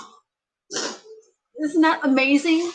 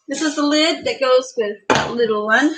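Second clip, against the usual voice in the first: ordinary delivery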